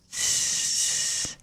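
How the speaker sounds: long, steady hissing sound; imitated fuse hiss.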